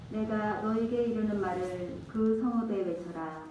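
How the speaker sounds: normal talking voice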